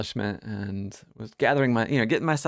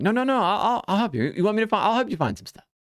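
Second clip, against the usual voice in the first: higher, fast, pushy insistence; insistent offer; 10 semitones higher, faster than usual. Retold or imitated. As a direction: push the offer on them, rushing. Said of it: "they asked again".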